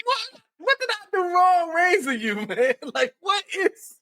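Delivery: high, laughing and crying disbelief; laughing mock disbelief. Father scolding his son for coming inside, laughing. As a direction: scold him while laughing hard. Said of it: "I was like".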